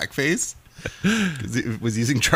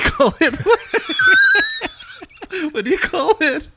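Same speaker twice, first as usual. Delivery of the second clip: laughing; 6 dB louder than usual. Real, not acted. Laughing through the joke setup.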